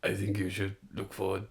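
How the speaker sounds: lightly imitated manager gone action star; Arsene Wenger. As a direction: say it low and quiet, with menace. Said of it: "turned into Dwayne The Rock Johnson".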